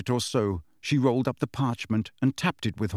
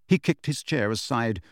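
plain reading voice

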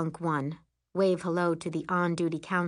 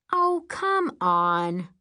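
scoffing; 5 dB louder, much slower than usual. Acted. Rachel scoffing at Liana's story.

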